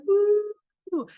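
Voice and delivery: very high, loud thrilled woo; water slide whoop. Pretend. Soul sliding down the galactic water slide, whooping very high. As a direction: whoop like you're flying down a slide.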